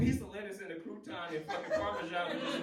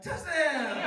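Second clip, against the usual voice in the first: loud, high excited "Touchdown!", laughing; excited shout; 5 semitones higher, 9 dB louder than usual. Retold or imitated. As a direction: yell "Touchdown!" like a thrilled fan. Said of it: "they gonna be like".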